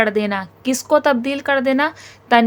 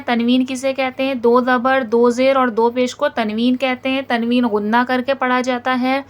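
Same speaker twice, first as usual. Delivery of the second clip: ordinary talk, nothing marked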